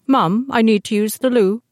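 put-on a toddler asking for the loo in a vague British accent; mock toddler accent. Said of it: "I don't know what the hell that accent was".

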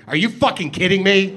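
host blurting at volcano announcement, disbelieving; mock outburst. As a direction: bark it like you can't believe it. Imagined blurt at pilot news.

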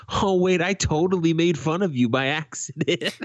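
high-pitched, imitated dawning embarrassment; sudden realization. Customer realizes he mocked the man behind, startled. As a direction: play a customer suddenly catching his blunder, high.

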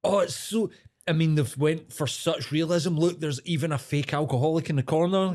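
mock hipster voice. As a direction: send up a hipster food reviewer. Mocking hipster pub review.

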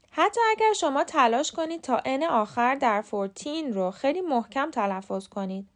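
ordinary delivery

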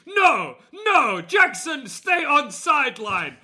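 high, slow monster refusal; hammed-up monster with broken English; angry Bigfoot voice. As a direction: order him back like a stubborn monster. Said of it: "when me and you do his voice".

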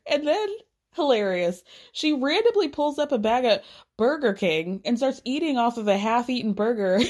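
usual speaking voice

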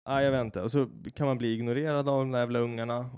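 normal talking voice

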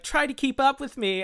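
high-pitched mock bratty boy; annoying teenage boy. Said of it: "with a horrible accent".

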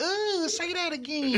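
put-on high, slow fawning girl voice; swooning girls. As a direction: imitate admiring girls, drawn out and high. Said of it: "all the girls are like".

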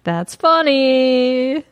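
drawn out. Real, not acted. Drawn out amused repeat.

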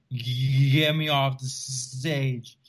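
slow, drawn-out stutter of mock panic; shaking, stuttering. Bombing comic begs the MC, imitated shaking stutter. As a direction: act out shaking so hard the words stick. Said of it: "My voice starts to shake".

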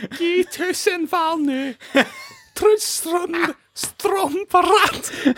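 the giant gibberish in a very high voice, slow; high-pitched voice. Shown as a demonstration. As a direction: pitch the invented Jotun words way up. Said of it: "What if you did it in a slightly high-pitched voice?".

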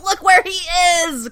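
high-pitched fan squeal; excited. Retold or imitated. Fans sharing the find all over LiveJournal, excited squeal. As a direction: re-enact gleeful showing-off. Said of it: "we were very excited to share it".

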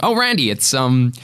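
high-pitched put-on American accent; mimicked American chirpiness. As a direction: awkwardly slip into American. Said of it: "starting talking in an American accent".

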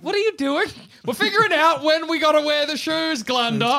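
high, lilting good witch voice; Glenda the good. Acted. Player voicing Glenda the good witch to the party, high and sweet. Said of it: "I'm Glenda the good".

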